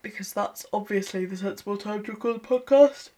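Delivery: talking through a yawn; sleepy, mid-yawn speech. Real, not acted. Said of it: "So much so that I'm actually yawning".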